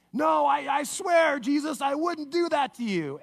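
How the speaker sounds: loud, high-pitched pleading oath; acted outburst of indignant loyalty; vehement protest. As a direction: swear it urgently, raising your voice. Peter protests his loyalty.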